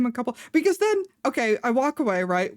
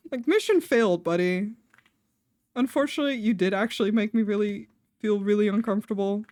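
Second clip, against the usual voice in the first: ordinary delivery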